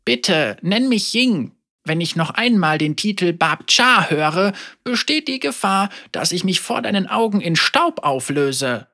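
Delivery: a hint of a grieving elder speaking frankly; old woman Ying. Elder Ying tells Yasuo she holds a grudge, gently voiced.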